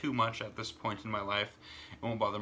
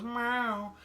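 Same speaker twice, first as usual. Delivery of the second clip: very high, falling meow; imitated cat meow; 13 semitones higher than usual. Owner imitates his kitty having the last word, very high meow. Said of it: "and she goes".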